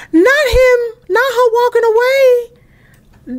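high-pitched, drawn-out disbelief; shocked outcry. Real, not acted. Player watching the wife walk away, shocked high squeal. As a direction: squeal in real dismay, stretching the words.

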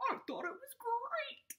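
whispered puppet reply; stage-whisper it to the teacher. Acted. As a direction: Gilbert puppet whispering his praise. Puppet Gilbert answers.

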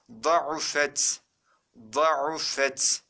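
"Da'ufet" with heavy D and throaty break before U; model reading. Shown as a demonstration. Teacher shows students how to read it, careful model reading. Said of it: "Nasıl okuyacağız?".

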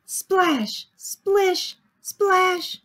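high, punchy paint-splash words; playful sound words. A narrator's reading. Teacher reading Sammy's painting scene to children, playful. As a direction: bounce each splash out separately.

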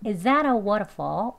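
"Is that a" merged into "izzada"; linked conversational pronunciation. Shown as a demonstration. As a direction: link it all, weakening "waterfall". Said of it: "그렇게 발음해보겠습니다".

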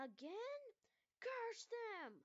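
high, loud, furious cry at the bombers; furious outburst. Acted. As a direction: rage at the attack, pitched high and loud. Airfield bombed at dawn.

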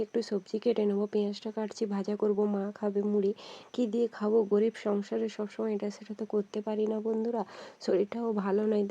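ordinary delivery

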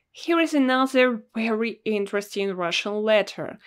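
ordinary delivery

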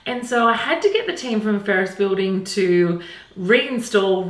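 bright, eager room tour; gushing makeover reveal. Acted. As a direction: show off the room proudly. Presenting the finished room.

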